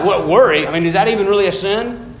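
loud, high scoffing disbelief; mock disbelief. Preacher mocks the church dismissing worry, loud incredulous scoff. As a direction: scoff as if worry could never be sin.

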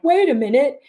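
the mind protesting, high and loud. Retold or imitated. The mind objecting to lightness, high startled protest. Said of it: "But the mind is like".

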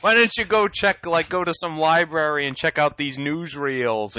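high, loud exasperated lecture; Taskmaster. Acted. Villain telling kids to look up old newsreels, exasperated. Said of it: "He was like".

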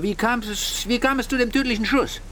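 insistent questioning. Acted. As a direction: press impatiently for an answer. Interrogator cuts in, repeats question.

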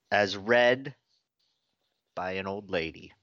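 usual speaking voice

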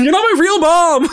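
put-on high, sulky teenager comeback; mock teen retort. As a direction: play the rebellious kid.

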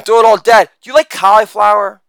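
usual speaking voice

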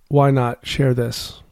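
usual speaking voice

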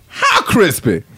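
Hungry Dude. Acted. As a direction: ask like an overexcited hungry dude. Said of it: "now Hungry Dude is".

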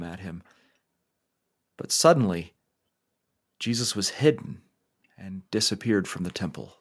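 unhurried storyteller leading a guided imagination; guided scripture meditation. Acted. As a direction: paint the scene gently so listeners can picture it. Guides listeners through imagined scripture scene.

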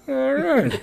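high-pitched, laughing mock agreement; mock tempted. Man retelling his tempted reaction to a hooker, high mock-tempted voice. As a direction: let it jump up high, half laughing.

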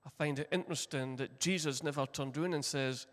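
ordinary talk, nothing marked

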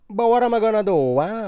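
over-the-top mock Italian accent, fast and loud; fake Italian accent. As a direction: play a cartoon Italian shrugging it off in an Italian accent. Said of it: "I ask myself in a fake Italian accent".

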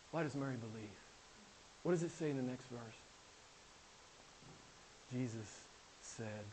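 very soft, low sermon voice; hushed preaching. Real, not acted. Preacher leading up to Jesus speaking, hushed preaching. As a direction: drop right down, leaving long pauses.

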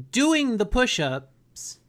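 ordinary talk, nothing marked

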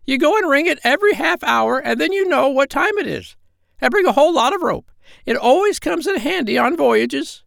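very high, squawky parrot voice; squawking parrot voice. Acted. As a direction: squawk it out like a bossy old parrot. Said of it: "said the parrot".

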